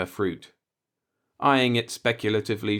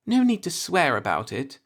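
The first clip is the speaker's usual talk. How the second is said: chiding; 9 semitones higher, 3 dB louder than usual. A narrator's reading. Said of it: "she chided him".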